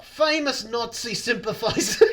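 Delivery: high, loud mock-Scottish announcement; bad Scottish accent. Host finishes joke introduction despite heckling, hammed-up Scottish accent. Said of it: "Do Scottish next".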